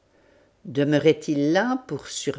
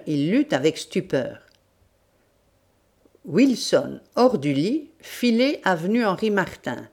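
plain reading voice

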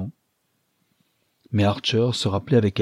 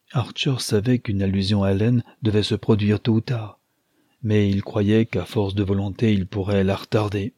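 ordinary delivery, nothing marked

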